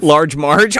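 ordinary talk, nothing marked

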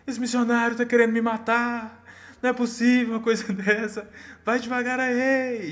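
imitação de um homem gritando, bem mais aguda e alta; gritando imitado. Retold or imitated. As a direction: grite reclamando, agudo e forte, e arraste o final. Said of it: "E ele gritando". (homem ferido reclama do motorista, grito agudo e alto)

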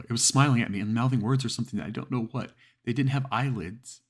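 high, loud and rushed with terror; panicked caller. Acted. Frightened woman telling the dispatcher about the face, panicked. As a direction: plead for help as if something is at the window. Terrified caller on emergency call.

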